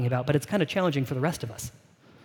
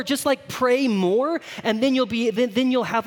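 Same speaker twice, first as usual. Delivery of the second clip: higher, drawn-out parody of pious advice; mock urging; 7 semitones higher than usual. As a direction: egg them on in a mock-eager voice. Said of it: "that's often how we talk about".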